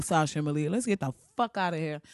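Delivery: ordinary talk, nothing marked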